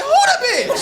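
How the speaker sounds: high, squeaky "who the bitch?"; nosy bystander voice. Retold or imitated. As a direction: pop your head out and squeal it. Bystander pops his head out.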